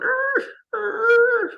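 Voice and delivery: high, yelping dog whine imitated; dog whining. Telling the porch-dog story, imitating the dog's high whining cry. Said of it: "the dog is whining".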